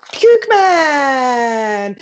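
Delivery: drawn-out title cry, falling away; game title announcer. Acted. Comic revealing the game she drew, long announcer cry. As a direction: call the name out and stretch the last word.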